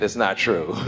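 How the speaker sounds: soft, chuckling aside under the breath; a quiet amused mutter through a laugh; laughing quietly. Real, not acted. Quiet laughing self-correction.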